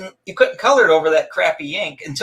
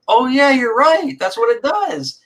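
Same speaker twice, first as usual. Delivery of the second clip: mock realization; 8 semitones higher, slower than usual. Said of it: "You're like".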